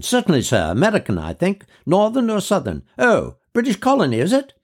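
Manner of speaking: high, rising shopkeeper voice, puzzled; a shop clerk, increasingly unsure; fussy shopman. Acted. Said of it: "said the shopman".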